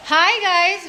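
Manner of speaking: high, loud hello to viewers; bright greeting. Real, not acted. Vlogger greeting her channel viewers, high and loud.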